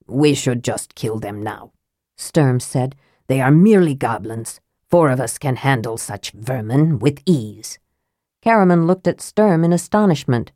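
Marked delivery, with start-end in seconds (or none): 0.1-1.7 lower knight voice. Acted. Sturm voiced low. 3.3-7.8 lower knight voice. Acted. Sturm voiced low.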